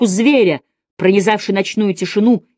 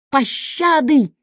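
drawn out, pitched much higher; desperate cry for mercy; 8 semitones higher, much slower than usual. A narrator's reading. The master on his knees begs the physician for mercy. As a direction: beg for mercy in a slow, high wail. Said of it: "вскричал мастер".

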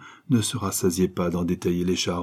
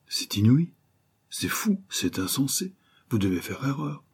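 high-pitched, faint bewilderment; stammering disbelief; 6 semitones higher than usual. A narrator's reading. Wide-eyed detective told the dead man is gone, bewildered babble. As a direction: protest weakly in a high, astonished voice. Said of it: "balbutia, en écarquillant les yeux".

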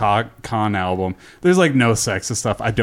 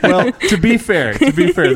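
laughing; 7 semitones higher, 5 dB louder than usual. Real, not acted. Laughing defensive.

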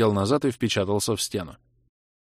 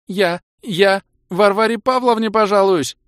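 high, loud, slow female cry; distraught threat; 12 semitones higher, 8 dB louder than usual. Acted. Kira threatening to tell on the fighters, distraught.

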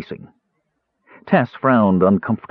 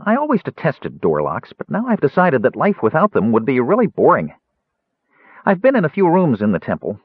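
light, high-pitched kender chatter; chatty young kender; 6 semitones higher than usual. A narrator's reading. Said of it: "blissfully ignored".

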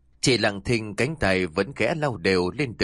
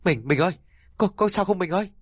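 high, frantic plea to his fallen wife; panicked husband; 9 semitones higher, faster than usual. Acted. Husband crying out to his collapsed wife, panicked. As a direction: beg her to answer, panicking.

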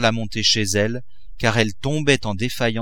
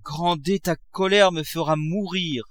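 higher-pitched plaintive wife; a touch of anguish, raised pitch; poor suffering wife; 8 semitones higher than usual. A narrator's reading. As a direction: plead as a distressed wife, lightly acted. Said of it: "dit la pauvre femme".

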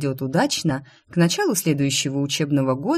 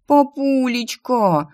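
high, slow, sugary "Папулечка"; wheedling plea; 8 semitones higher, 3 dB louder, much slower than usual. Acted. Daughter using her forbidden trick on father, high drawn-out wheedling. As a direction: play the last trick, stretch the word sweetly. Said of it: "пошла я на запрещённый приём".

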